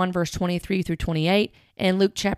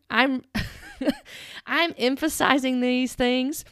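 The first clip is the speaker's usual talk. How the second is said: laughing; 5 semitones higher, slower than usual. Real, not acted. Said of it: "I'm putting the emphasis on the syllables".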